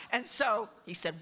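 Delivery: ordinary delivery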